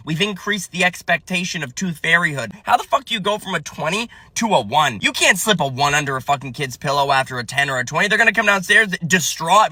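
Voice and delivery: usual speaking voice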